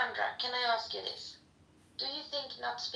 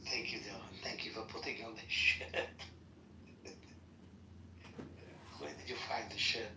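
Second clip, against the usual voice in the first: low mock voice; 12 semitones lower, 7 dB quieter than usual. Teasing Dylan in low voice.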